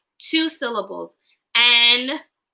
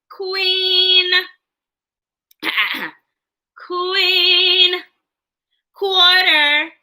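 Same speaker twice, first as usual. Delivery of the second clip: stretched word; 5 semitones higher, much slower than usual. Shown as a demonstration. Demonstrates stretched word in game.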